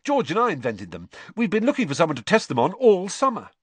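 bright. A narrator's reading. Fred telling Harry about his new invention, brightly. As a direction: rattle it off brightly. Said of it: "said Fred brightly".

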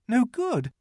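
put-on high, startled child's question; sudden high note of fright; rather frightened. A narrator's reading. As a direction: jump up in alarm. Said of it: "feeling rather frightened".

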